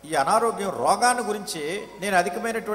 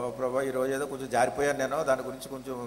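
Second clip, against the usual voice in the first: lightly acted casual confession, lower pitch; half-hearted prayer; 6 semitones lower, 4 dB quieter than usual. Interpreter echoing a casual prayer of apology, half-hearted. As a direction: give a token apology in a low voice. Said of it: "అలా ప్రార్థన చేయట్లేదు".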